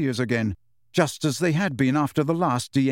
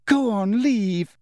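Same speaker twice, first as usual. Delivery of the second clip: high, tearful dismissal; crying; 8 semitones higher, much slower than usual. A narrator's reading. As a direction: sob the words out high. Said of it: "now crying into the napkin".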